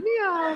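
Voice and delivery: tag on a dainty meow; cat meow. Acted. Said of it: "like the cat".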